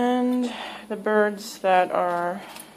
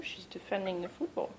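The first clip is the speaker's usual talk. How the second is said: ordinary talk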